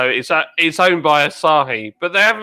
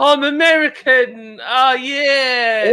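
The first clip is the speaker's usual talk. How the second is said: hammed-up American accent, higher; mock American; 7 semitones higher, much slower than usual. Friend mocks Americans in a put-on American accent.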